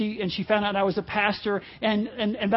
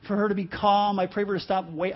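normal talking voice

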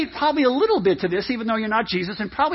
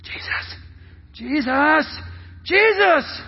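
calls building from near-whisper to high cry; childlike pestering; 5 semitones higher, slower than usual. Acted. Disciples bugging Jesus like kids, pestering calls that build. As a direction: nag like a little child, rising each time. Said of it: "like little children, started bugging him".

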